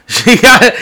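bursting out laughing. Real, not acted. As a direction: laugh through the words. Laughing mid-sentence.

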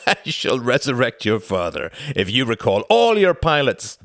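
taunting villain, mocking laugh; menacing count. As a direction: sneer down at the fallen man. Count Orski taunting Klaus.

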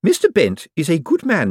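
high, earnest defence; Miss Drapes defending. Acted. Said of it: "said Miss Drapes".

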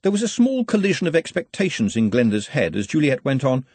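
ordinary delivery, nothing marked

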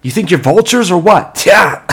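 high loud taunting yell; mocking shout. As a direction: jeer at the birds. Taunting crows loudly.

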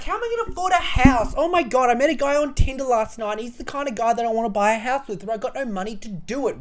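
high, fast mocking girly voice; a touch of a ditzy young woman; mock airheaded girl. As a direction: ham up a shallow Tinder girl. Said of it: "You're like".

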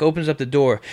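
usual speaking voice

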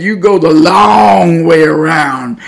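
"long" stretched out loud and slow; quoted teasing, with "long" held to mimic the detour; drawn-out LONG. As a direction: lean hard on "long" and drag it out. Relative teasing about roundabout explaining.

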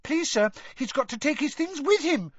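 very high, pleading squeak; small boy's anxious squeak; squeaking schoolboy. A narrator's reading. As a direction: pipe up urgently to the teacher. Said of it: "squeaked Colin".

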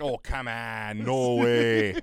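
put-on slow, drawn-out, loud Wisconsin accent; hammed-up Wisconsin accent reacting to a Brewers game. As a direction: stretch the vowels like a Wisconsin sports fan. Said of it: "I'm gonna come back with the accent".

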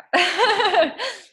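high, loud peal of laughter; laughing. Real, not acted. Guest admitting a nightly sea salt habit, laughing.

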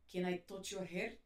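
put-on slow, low Russian-accented English. Russian shop owner asks to touch her hair, imitated Russian accent. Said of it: "o R deles é bem carregado".

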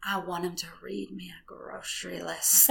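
whispered with a laugh; giggly whisper. Real, not acted. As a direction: whisper the fantasy while giggling. Confessing fantasy, giggling.

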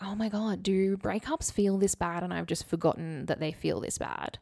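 ordinary talk

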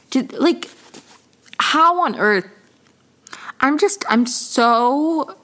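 real angry exasperation. Real, not acted. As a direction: let the frustration break through. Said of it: "it really makes me angry".

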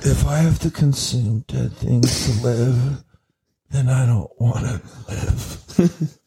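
slow, low, weary mock lament; mock dramatic star. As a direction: sigh it out like a tortured artist. Mock Chalamet, dramatic vegan.

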